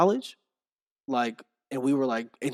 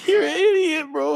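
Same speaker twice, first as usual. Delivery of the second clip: high, loud through laughter; laughing at a friend's joke, high and loud; 5 dB louder than usual. Real, not acted. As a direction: squeal it while cracking up.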